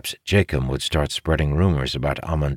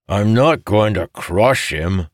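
slower, louder, higher-pitched retort; 6 semitones higher, 5 dB louder, slower than usual. A narrator's reading. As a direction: push back with raised pitch and volume. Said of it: "Mojadian retorted".

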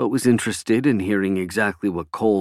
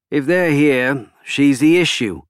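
higher, slow, emphatic character voice; deliberate, raised, pointed delivery; Cole, insistent politician; 5 semitones higher, 4 dB louder than usual. A narrator's reading. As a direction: spell it out for a slow listener.

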